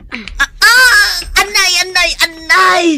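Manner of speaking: high, loud cries of pain; screaming ouch at the sore foot; pained screams. Acted. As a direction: yelp out each "anay" as the massage hurts. Massage hurts the old woman.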